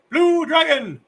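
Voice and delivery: excited exclaim. Acted. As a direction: announce the choice with excitement. Choosing the role, exclaimed.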